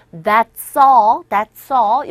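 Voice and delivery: "That's all" joined into "that-saw"; loud model, S of "That's" carried straight into "all"; linked pronunciation. Shown as a demonstration. Teacher tells learners to connect it, "That's all" linked as "that-saw". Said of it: "연결하십시오".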